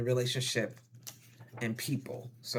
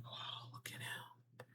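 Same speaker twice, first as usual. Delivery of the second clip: slow, hushed whisper of awe; drawn-out whispered admiration; admiring whisper; 21 dB quieter, slower than usual. Acted. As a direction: whisper like an onlooker nudging a friend. Voices onlookers remarking on him.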